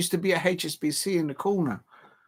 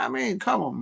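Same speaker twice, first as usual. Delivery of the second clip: normal talking voice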